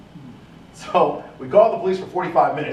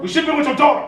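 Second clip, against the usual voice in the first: much higher, louder yell; scolding shout; 13 semitones higher, 5 dB louder, faster than usual. Retold or imitated. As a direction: berate her. Sergeant scolds mother.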